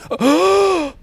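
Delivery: high, sharp gasp of surprise; startled gasp; shocked gasp. Real, not acted. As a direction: gasp at the sudden hit. Sudden death in game.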